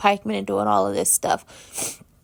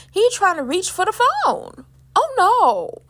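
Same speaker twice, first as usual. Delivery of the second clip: worried; 10 semitones higher, slower than usual. Real, not acted. Recapping the collapse for viewers, high and anxious. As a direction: fret as he reaches for the phone. Vlogger watching him collapse.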